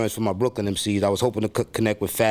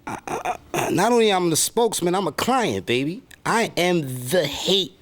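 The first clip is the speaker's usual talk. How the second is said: much higher, aggrieved put-on rapper voice; mad rapper persona; 9 semitones higher than usual. Rapper alter ego complaining to interviewer, high put-on voice. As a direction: gripe like a bitter, neglected rapper stranded alone. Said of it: "from the perspective of the mad rapper".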